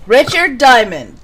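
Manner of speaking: ordinary delivery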